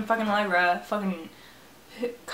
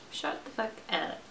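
hushed, breathy mock put-down; whispered insult; 10 dB quieter than usual. Gamer whispering an insult at her avatar. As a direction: hiss it under the breath.

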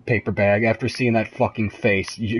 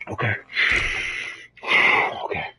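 breathless whisper; 4 dB quieter than usual. Real, not acted. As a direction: barely breathe it out. Relief after tense moment.

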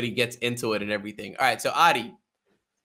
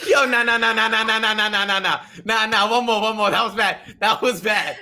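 higher and louder, laughing through the words; 9 semitones higher, 6 dB louder than usual. Real, not acted. Host laughs off his own failed roar, loud and high. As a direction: crack up at your own bad roar.

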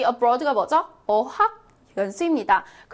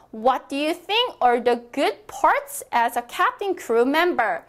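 slow, clear model sentence for repeating; teacher reading aloud slowly for practice; much slower than usual. Shown as a demonstration. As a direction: read it slowly so students can follow. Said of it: "소리 크게 내어주세요".

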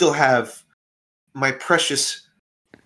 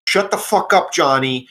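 angry silencing; 2 semitones higher, 4 dB louder than usual. Acted. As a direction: shut down an annoying underling. Acted quarrel, boss silences underling.

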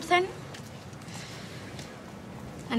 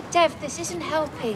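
usual speaking voice

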